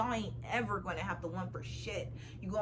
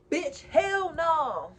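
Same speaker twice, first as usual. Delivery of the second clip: emphatic shout; 6 semitones higher, 9 dB louder, much slower than usual. Real, not acted. Emphatic refusal.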